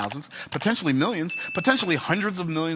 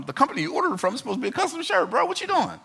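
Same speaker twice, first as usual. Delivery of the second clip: high, fast mock of the tailor brushing him off; 11 semitones higher, faster than usual. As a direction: imitate the tailor passing the blame, fast and high. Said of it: "He's like".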